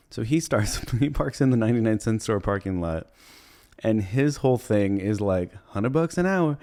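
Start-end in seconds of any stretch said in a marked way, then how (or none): 5.7-6.6 high pitched hustler sales call; mock hawker shouting the price. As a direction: sell it like a street hustler. Pitching his van business.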